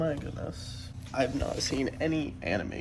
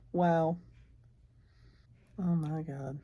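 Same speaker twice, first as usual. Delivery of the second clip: ordinary talk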